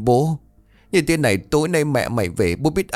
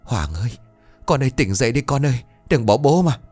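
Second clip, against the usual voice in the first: wailing and crying; 4 semitones higher than usual. Acted. Father crying over his son, wailing sob. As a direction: wail over the lost son. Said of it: "Vũ vừa gào vừa khóc".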